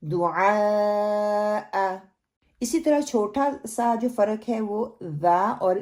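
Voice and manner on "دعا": دعا held very long; teacher sounding out دعا very slowly; clear slow دعا. Shown as a demonstration. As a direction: stretch out دعا, making ain clear. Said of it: "make it clear".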